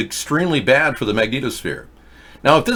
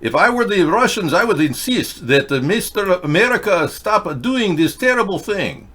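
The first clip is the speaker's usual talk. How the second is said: hammed-up Russian accent, pitched higher; mock Russian accent; 8 semitones higher than usual. Host mocking Russians in a put-on Russian accent. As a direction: play a broadcaster faking a Russian accent. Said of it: "I even do a better Russian accent than you people do".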